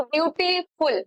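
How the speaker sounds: Beautiful said slowly, syllable by syllable; broken into syllables. Shown as a demonstration. Teacher showing students where the sound breaks, slow syllable-by-syllable beautiful. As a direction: split beautiful into beau, ti, ful with small breaks. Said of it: "ऐसे करके ना".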